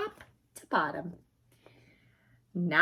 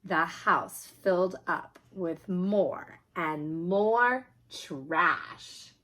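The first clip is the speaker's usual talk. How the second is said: usual speaking voice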